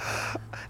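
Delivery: sharp gasp of shock; breathless retold gasp. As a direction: suck in air like your heart just dropped. Discovering plants gone, heart dropped.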